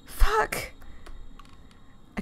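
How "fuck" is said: sudden high yelp of a curse; startled curse. Real, not acted. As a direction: blurt it out, caught off guard. Lost alone in woods.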